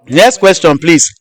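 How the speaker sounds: ordinary talk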